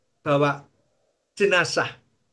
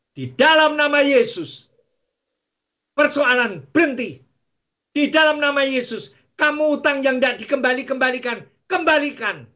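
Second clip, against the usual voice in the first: raised, declaring the name of Jesus; preaching at full force; 7 semitones higher, 3 dB louder than usual. Acted. Preacher proclaims to the congregation, raised full-force preaching.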